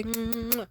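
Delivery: loud smacking kiss; kiss sound. Retold or imitated. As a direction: plant another kiss. He kisses her forehead.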